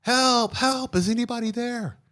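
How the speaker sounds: high, frantic call for help off a cliff; a hiker dangling, yelling up for anyone; high desperate calling out; panicked cry for help. Acted. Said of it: "and he's like".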